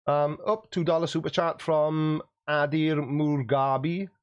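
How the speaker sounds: usual speaking voice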